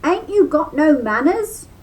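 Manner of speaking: cross high rabbit demand. A narrator's reading. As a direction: scold him for being rude. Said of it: "Brer Rabbit asked crossly".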